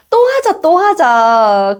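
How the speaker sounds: put-on high, drawn-out child's begging; pestering child. Child begging to play, acted.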